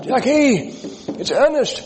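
high, loud call through the door. Retold or imitated. Neighbor calls at the door for Jackie, high and loud. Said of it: "and calls out".

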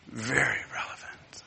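sudden whispered emphasis. Real, not acted. Preacher hushing the congregation, whispered. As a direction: drop to a hush on it.

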